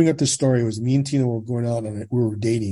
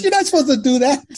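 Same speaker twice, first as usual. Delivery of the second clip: high, loud mock protest; exasperated retold objection, pitched way up; imitated shocked scolding; 6 dB louder than usual. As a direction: cry out as the alarmed friend. Friend scolds him, retold.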